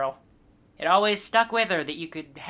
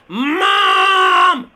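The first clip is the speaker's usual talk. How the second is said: very slow, drawn-out yell; shouting; 10 semitones higher, 11 dB louder, much slower than usual. Acted. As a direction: shout it out long and slow into the phone. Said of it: "You're gonna have to speak up".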